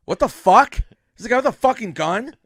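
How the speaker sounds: high, loud outburst; mock alarmed shout. Sandler hearing of a gunman, loud mock shout. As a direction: blurt it out in shock.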